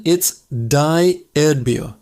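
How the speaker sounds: ordinary talk, nothing marked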